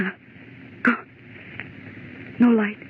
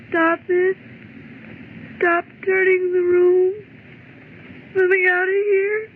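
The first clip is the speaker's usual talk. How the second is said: higher and higher, frantic pleading; rising panic; 7 semitones higher than usual. Acted. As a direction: hysterical plea to be let out. Woman panics as room turns.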